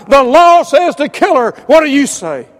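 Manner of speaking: loud, high accusing crowd. Retold or imitated. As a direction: shout the demand at him. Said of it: "Maybe they got louder".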